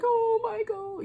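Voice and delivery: high, slow, lovestruck gasp of his name; swooning sigh. Retold or imitated. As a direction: melt over the book boyfriend. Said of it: "was like".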